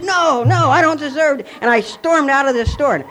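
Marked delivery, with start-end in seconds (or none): 0.0-1.5 distraught refusal. Retold or imitated. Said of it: "He said".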